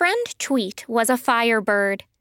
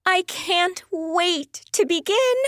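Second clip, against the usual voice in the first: high-pitched, eager character voice; can hardly wait, pitched up; eager excitement; 7 semitones higher than usual. Acted. Pearl eager to start magic.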